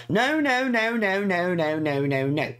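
panicked no. Acted. The diary girl panics as things get into her mouth, high and fading. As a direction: start shrill and let each no sink.

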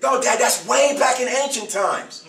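put-on high, loud, slow child's protest; mock kid insisting loudly it's ancient history. Father voicing his children's disbelieving protest, high and loud.

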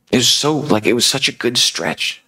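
high-pitched, mildly distressed lament; almost upset. Acted. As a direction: let some frustration lift the voice. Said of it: "She's almost upset".

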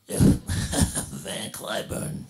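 very slow, breathy secret hint; hushed stage whisper. Retold or imitated. Father tipping off the maitre d', slow hushed whisper. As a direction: lean in and breathe the name. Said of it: "my father says to him".

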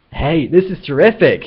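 loud, high-pitched excitement; thrilled outburst. A narrator's reading. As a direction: burst out with delighted amazement. Zaphod thrilled at being attacked.

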